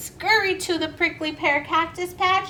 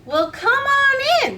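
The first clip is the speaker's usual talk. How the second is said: much higher, louder warm invitation; welcoming call; 12 semitones higher, 7 dB louder, faster than usual. Acted. Miss Billy inviting friends inside, high and loud. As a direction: call them in brightly.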